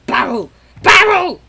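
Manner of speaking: high and loud, a yelled find; spotting a barrel in game; excited shout. Real, not acted. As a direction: shout it out gleefully. Calling out at barrel.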